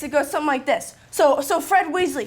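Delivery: normal talking voice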